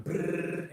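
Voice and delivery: lips buzzing like a money printer; money printer brrr. Retold or imitated. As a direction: buzz it out like a printing press.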